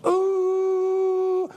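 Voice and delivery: high, long steady mock flatline tone; a high held tone like a flatline; flatline tone imitation. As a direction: imitate a heart monitor flatlining. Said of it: "then you hear it go".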